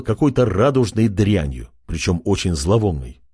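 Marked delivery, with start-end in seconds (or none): none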